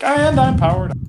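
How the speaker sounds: spring back online with a high chirp; bright jump up in pitch, fully charged; powered-up robot. Pretend. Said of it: "you're like".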